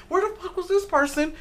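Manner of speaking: high-pitched disbelief; amazed. Retold or imitated. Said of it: "Be like".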